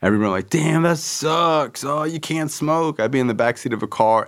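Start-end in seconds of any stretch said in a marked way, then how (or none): 0.5-3.0 high, slow fake sympathy; higher mocking sympathy; mock pity. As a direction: tease him with drawn-out pity.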